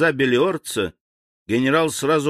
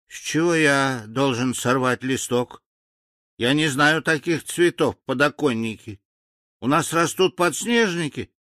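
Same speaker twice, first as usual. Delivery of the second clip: baffled native; 6 semitones higher than usual. A narrator's reading. Baffled second native questions order.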